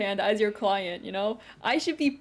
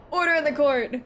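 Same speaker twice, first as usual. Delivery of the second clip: louder, higher call to order; judge's shout; 5 semitones higher, 6 dB louder than usual. Acted. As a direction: bang the gavel with your voice. Judge calls courtroom to order.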